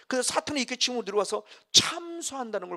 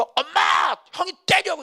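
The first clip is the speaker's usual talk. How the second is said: shrill high yell to mom; tattling shout; 10 semitones higher, 6 dB louder, much slower than usual. Retold or imitated. Little brother yelling to mom to tattle. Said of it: "이렇게 이르지".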